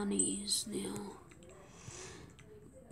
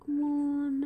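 urging whisper; 4 semitones higher, 4 dB louder than usual. Real, not acted. Urging trade partner.

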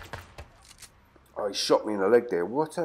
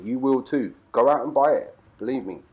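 normal talking voice

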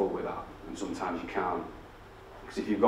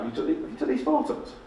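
high-pitched imitated nan question; hopeful nana. Nana still looking for the grandchild's part, hopefully asking. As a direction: keep hoping in a raised nana voice. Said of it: "She's like".

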